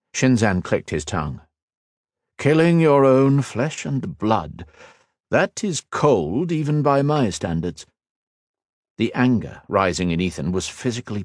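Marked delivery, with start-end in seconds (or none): 2.4-7.9 higher, slow, silky menace; sneering villain. Acted. Shinzan sneering at Ethan over his dead cousin, slow menace. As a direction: savour every cold word.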